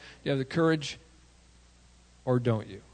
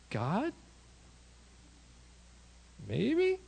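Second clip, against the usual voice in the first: doubting; 9 semitones higher, 3 dB quieter than usual. Retold or imitated. Hesitant doubting prayer, contrasted with bold.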